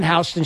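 first syllable of Houston rhymes with "cow"; local street pronunciation. Shown as a demonstration. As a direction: say Houston as "HOW-stun". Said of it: "it's pronounced Houston".

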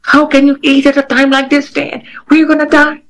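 high, panicky lady voice; a hammed-up frightened woman; imitating panicked supervisor. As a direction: mimic her shrieking panic at lunch. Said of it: "the lady's like".

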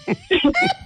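squealing high laugh. Real, not acted. Caller laughing at the host's joke, high laugh.

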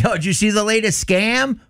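playful pretend office chatter; joking fashion. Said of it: "bringing up in a joking fashion".

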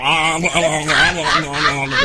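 slow garbled groan round a tube; intubated moaning. Pretend. Host playing himself awake but intubated, muffled moaning. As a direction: groan wordlessly as if a tube's in your throat. Imitates intubated patient talking.

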